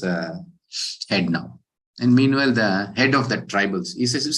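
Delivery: ordinary delivery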